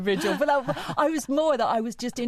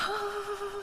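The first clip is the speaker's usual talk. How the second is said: high, quiet intake of breath; eager mock gasp; 14 semitones higher, 8 dB quieter than usual. Co-host awaiting the crush reveal, eager gasp. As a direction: gasp in anticipation of gossip.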